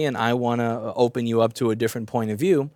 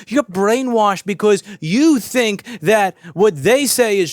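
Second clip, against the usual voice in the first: loud, slow, sneering put-down; mock condescending rant; 5 semitones higher, 6 dB louder, slower than usual. Mocking a smug pundit, loud and slow. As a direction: talk down to them like they're brainwashed. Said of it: "If I just look at them and go".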